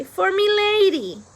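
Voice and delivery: high, loud, slow announcement; loudly. A narrator's reading. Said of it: "Meg said loudly".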